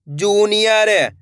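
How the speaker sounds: word stretched very slowly; drawn-out reading. Shown as a demonstration. Teacher reading a word for learners, drawn out. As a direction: read it out with long held vowels. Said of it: "एक अक्षर का उच्चारण करना है".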